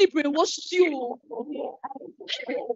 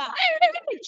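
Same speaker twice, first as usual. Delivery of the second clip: sharp, much higher cry of hey, hey to cut her off; alarmed interruption; 11 semitones higher than usual. Acted. Friend is shocked and cuts off the pregnant woman's rubbish. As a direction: stop her mid-sentence in shock.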